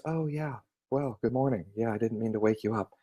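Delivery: match a just-woken man quietly; soft sleepy tone. Shown as a demonstration. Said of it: "naturally fell into, into space with him".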